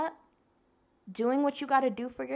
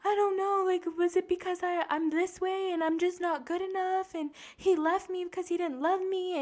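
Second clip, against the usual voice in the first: high, tearful whine; pitched-up blubbering, retold and exaggerated; mock bawling; 9 semitones higher than usual. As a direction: imitate yourself crying through self-doubt. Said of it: "bawling my eyes out crying".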